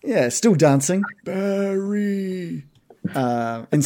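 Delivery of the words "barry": soft, high, drawn-out croon; sexy groove. Pretend. Game master playing Barry grooving over, mock sexy. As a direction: croon the name playfully, stretched out. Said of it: "he's just sexy".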